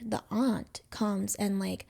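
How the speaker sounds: usual speaking voice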